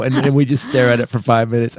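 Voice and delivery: ordinary talk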